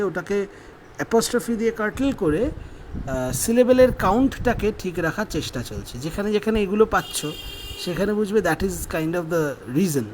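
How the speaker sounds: ordinary talk, nothing marked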